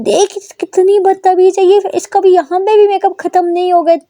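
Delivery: high, loud furious ranting; mother shouts about her daughter's rudeness. A narrator's reading. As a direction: rage at the rude daughter. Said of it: "वो shout करती है".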